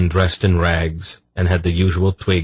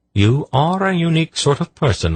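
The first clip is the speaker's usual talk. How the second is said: fond; 11 semitones higher than usual. Acted. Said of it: "smiling fondly".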